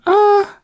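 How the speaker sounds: very high-pitched little "ah"; high mock squeal; imitated reaction noise. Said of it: "they kinda just went".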